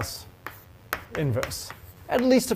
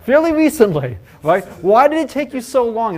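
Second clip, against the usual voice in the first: mock incredulous; 6 semitones higher, 9 dB louder than usual. Real, not acted. Teasing students about learning sigma.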